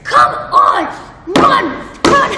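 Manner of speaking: high, loud shouting to keep running; encouraging yell. Retold or imitated. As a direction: yell her toward the finish. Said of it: "he starts yelling at me".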